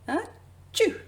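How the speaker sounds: loud high mock sneeze. Acting the chilly cat from Brazil, loud high sneeze. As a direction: burst out a big cartoon achoo.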